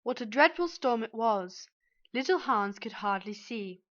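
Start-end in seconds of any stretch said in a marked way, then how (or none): none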